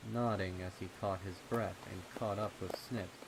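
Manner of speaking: usual speaking voice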